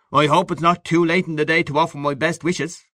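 hearty congratulating Gallagher. Acted. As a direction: offer expansive good wishes to an old friend. Gallagher congratulates his friend.

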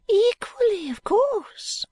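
put-on high, fluttery old lady, whispering bits; a rambling old woman clinging to hope; old Miss Flite.

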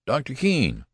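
high-pitched urgent hail; calling out. A narrator's reading. Anxious father running toward the doctor, calling out. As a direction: call his name urgently. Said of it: "he called".

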